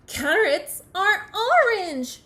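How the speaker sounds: high, loud and slow; exasperated storybook yell; indignant shout. Acted. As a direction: shout each word in outrage. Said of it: "shouted Bird".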